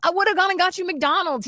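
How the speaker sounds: high, mildly whiny put-on voice; imitating fiance's protest. Fiance offering a hangover rescue, imitated protest. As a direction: pitch it up as a pleading partner.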